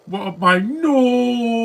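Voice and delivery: high, loud, very slow whiny voice; mock whiny voice. Mocking a movie character with a high, loud, whiny voice.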